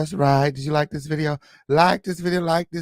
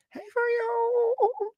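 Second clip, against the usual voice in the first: very high falsetto hello; squeaky greeting; 4 dB quieter than usual. Acted. Host greeting a viewer in falsetto. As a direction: pop up into falsetto.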